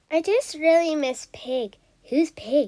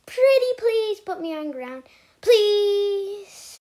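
pleading; 6 semitones higher, 4 dB louder, slower than usual. Acted. Begging the bird to land.

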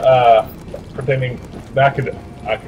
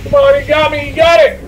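excited cheering; 14 semitones higher, 4 dB louder than usual. Real, not acted. Cheering teammate during a fight.

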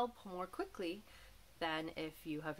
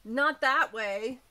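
louder, slow alarmed call; crying out; 4 semitones higher, 9 dB louder, slower than usual. A narrator's reading. Mom cries out at the moose, loud and slow. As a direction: call out in dismay. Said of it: "cried Mom".

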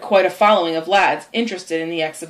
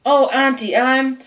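higher, pitiful mock moan; feigned suffering; 5 semitones higher, slower than usual. Tom to his worried aunt, feigned suffering. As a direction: act too ill to finish.

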